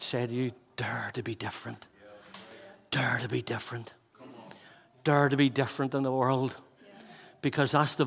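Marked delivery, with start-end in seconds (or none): 0.8-1.9 softer, low-voiced urging; quiet urging. Pastor urging young people, quiet and intense. 2.9-3.9 whispered, breathless challenge; whispered urging. Acted. Pastor repeating his challenge to young people, whispered.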